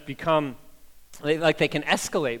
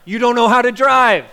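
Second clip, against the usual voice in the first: higher, louder driver scolding; mock angry yell; 7 semitones higher, 10 dB louder than usual. Pastor yells at the other driver, half-hammed anger. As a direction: yell it through the window.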